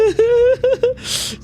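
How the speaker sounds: squeaky burst of laughter; high laugh. Real, not acted.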